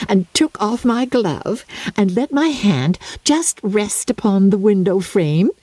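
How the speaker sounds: put-on high, breathless chatter of a silly girl; Lydia, giddy young bride. Lydia boasting to her family, high and giddy. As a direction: gush it out like a spoilt bride.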